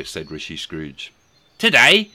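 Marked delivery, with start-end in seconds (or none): 1.6-2.1 put-on boy voice. Boy answers Scrooge.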